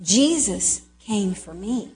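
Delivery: slow, gentle confession; slow realization. Retold or imitated. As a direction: say it slowly as if just understood. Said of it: "And when you go".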